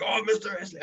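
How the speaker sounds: high, excited fan calling his name; mock fan call. Fan spotting a wrestler at the mall, squealing excitedly. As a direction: gush like a starstruck fan.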